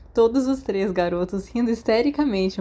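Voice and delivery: usual speaking voice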